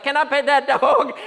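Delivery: a hint of an eager Appalachian child; little girl begging to pet the bear. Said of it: "And she's just like".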